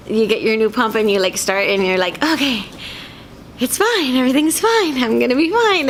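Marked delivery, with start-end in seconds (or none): 2.2-5.9 forced calm. Retold or imitated. Forcing herself calm after malfunction.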